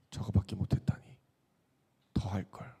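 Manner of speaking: whispered thought. Retold or imitated. Pastor voicing his boyhood revenge thought to the congregation, whispered. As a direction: breathe the revenge thought under your breath.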